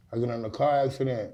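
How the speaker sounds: ordinary talk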